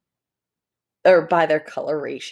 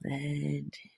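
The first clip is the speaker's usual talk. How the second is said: very soft whispered blend in; hushed unvoiced whisper; 4 semitones lower, 15 dB quieter than usual. Shown as a demonstration. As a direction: breathe it out like you are hiding. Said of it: "we go".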